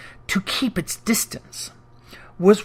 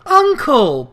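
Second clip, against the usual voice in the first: high, louder pleading cry; 7 semitones higher, 6 dB louder than usual. A narrator's reading. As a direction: beg him to soften. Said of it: "pleaded the nephew".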